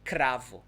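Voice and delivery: "cravo" with a rolled R after the C; vibrating R. Shown as a demonstration. Teacher modelling the second example word, vibrating R. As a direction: trill the R in "cravo". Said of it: "it sounds like R again".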